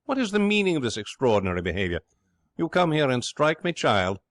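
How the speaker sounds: high, loud indignant protest; outraged yell. Acted. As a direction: howl it out, scandalised. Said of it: "he yells".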